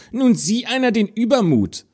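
put-on a sneering older sister calls out; jealous stepsister. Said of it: "rief die Stiefschwester".

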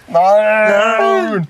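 high, loud playful scream of no; mock panicked shout. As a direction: cry out in fake horror. Mock panic, hiding from people.

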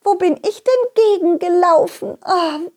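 high, dreamy lady donkey, a bit dizzy; dazed lady donkey. Acted. Lady donkey after bumping heads with the donkey, dazed and high. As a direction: she just bumped heads and shakes it off.

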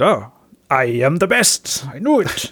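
slow, hammed-up English gentleman; theatrical British inspector. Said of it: "es ist sehr theatralisch".

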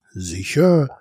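a touch of a teasing grin in the voice; slight grin. A narrator's reading. Narrator teasing the dragon about penguins, slightly grinning. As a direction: confirm it with an understated grin. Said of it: "sagte ich mit ein leichtes Grinsen".

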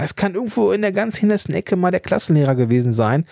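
normal talking voice

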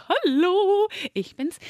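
high, sheepish greeting, caught out; a bright, guilty hi to a startled friend; sheepish hello. Retold or imitated. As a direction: play innocent with a high hello. Caught sleeping in friend's bed.

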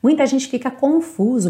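ordinary talk

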